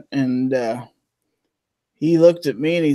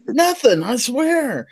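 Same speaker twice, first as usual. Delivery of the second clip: higher, innocent protest; mock innocent denial; 9 semitones higher, slower than usual. Matt denying the prank, higher innocent voice. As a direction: play innocent. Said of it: "He goes".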